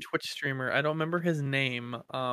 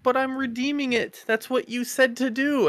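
high, raised voice of mock protest; imitated protest; 10 semitones higher, 4 dB louder than usual. Streamer answers the raging scammer, lightly imitated high-pitched protest. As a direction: act out the prankster arguing back, pitch way up. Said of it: "And he's like".

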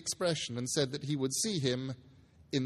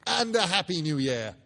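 laughing, louder festive wish; laughing cheer; 4 semitones higher, 5 dB louder than usual. Acted. Nephew laughing his New Year wish at Scrooge. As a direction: laugh it out as a parting shot.

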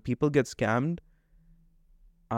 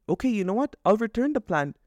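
fed-up sarcasm; 7 semitones higher than usual. Retold or imitated. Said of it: "ask them".

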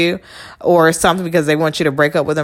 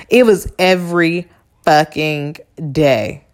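normal talking voice

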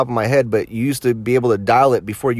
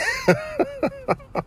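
much higher squeal of a laugh; 6 dB quieter than usual. Real, not acted. Laughing at the prank story, high squealing laugh. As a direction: break into a giddy squeaky laugh.

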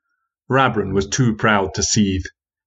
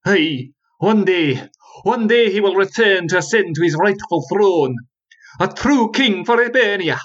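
put-on slow, raised old voice breaking with emotion; voice cracking; 11 semitones higher than usual. Old storyteller Rabrin foretelling the prince's return, voice cracking. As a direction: let the words crack as hope wells up. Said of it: "his aging voice began to crack".

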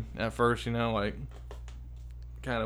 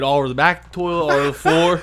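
ordinary talk

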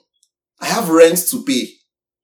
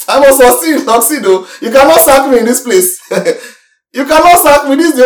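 louder and higher, insisting he must succeed; determined outburst; 6 semitones higher, 4 dB louder than usual. Retold or imitated. Pastor reliving his fight to keep the job, louder and determined. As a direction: push it out like a vow nobody can break.

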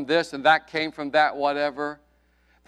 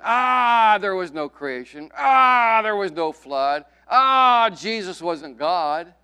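mocking scoffers; 3 semitones higher, 6 dB louder, slower than usual. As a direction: wave each belief away with scorn. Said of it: "the source of their conclusion".

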